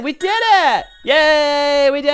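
high, drawn-out celebration; victory cheer. Real, not acted. Player celebrating completing all islands, high victory cheer. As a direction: whoop it up at the finish.

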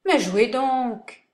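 slow, offhand; nonchalant. Acted. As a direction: toss it off lazily. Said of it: "Un marquis nonchalamment".

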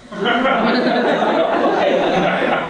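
laughs high at his own joke; real laugh; high burst of laughter. Real, not acted.

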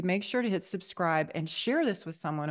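usual speaking voice

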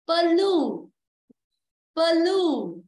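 Blue loud, split as "pơ-LU", weight on "lu"; stress on second syllable. Shown as a demonstration. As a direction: punch and stretch the second beat of Blue. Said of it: "blue là rơi âm hai". (Blue đọc to, nhấn âm hai "lu")

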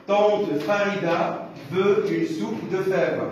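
measured dictation pace with clear gaps; slow careful reading. Shown as a demonstration. Teacher reads the passage to the class, slow careful reading. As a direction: read it out slowly, word by word. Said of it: "Je répète".

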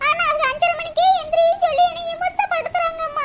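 complain, then burst into hammed-up tears; tearful complaint. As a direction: whine that ends in sobbing. Crying daughter complains of mother-in-law.

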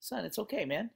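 high, lively pep talk from dad on the mound; encouraging coach dad. Retold or imitated. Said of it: "and he says".

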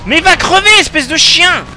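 furious high shouting; raging gamer. Real, not acted. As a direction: scream at the screen in rage. Raging at game opponents.

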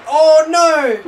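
loud high cry of dismay; dismayed shout. Real, not acted. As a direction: yell in shock.

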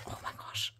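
breathless little whisper of delight; awed whisper. Real, not acted. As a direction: whisper it, overcome. Awed at the pen pouch.

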